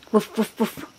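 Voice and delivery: three short, puffed woofs; playful dog noises. Shown as a demonstration. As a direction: bark like a dog for a toddler. Parent imitates dog for child.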